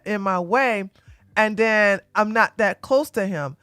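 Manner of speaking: ordinary talk, nothing marked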